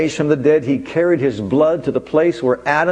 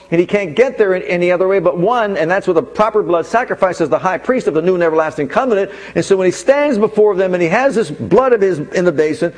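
rising preaching; 5 semitones higher than usual. Acted. Preacher building intensity for his congregation, rising preaching. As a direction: preach it up toward the climax.

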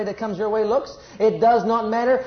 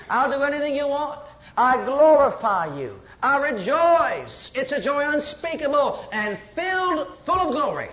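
high, fervent praise prayer; 7 semitones higher than usual. Acted. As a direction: cry it out to God in praise. Voicing the apostles' prayer.

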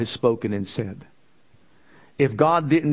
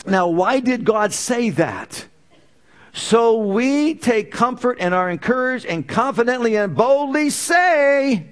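building preaching; 7 semitones higher than usual. Acted. Preacher building congregation to bold confession. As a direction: build it up to boldly say.

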